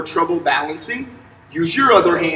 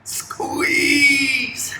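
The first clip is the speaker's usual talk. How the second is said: coaching call; 7 semitones higher, 8 dB quieter than usual. Shown as a demonstration. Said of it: "Act like you're hugging".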